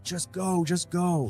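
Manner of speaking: panicked urging. Retold or imitated. Fleeing, urging grandfather.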